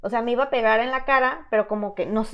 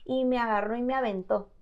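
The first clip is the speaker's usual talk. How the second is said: normal talking voice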